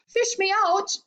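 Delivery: high, desperate plea; high-pitched drowning boy; crying out. A narrator's reading. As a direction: cry out to be rescued. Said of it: "cried Augustus".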